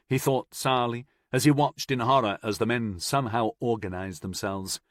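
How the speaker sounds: ordinary delivery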